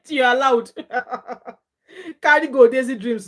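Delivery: high, loud, laughing go-ahead; laughing approval. Real, not acted. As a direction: cheer them on through a laugh. Laughingly lets the viewer carry on.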